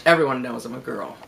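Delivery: ordinary delivery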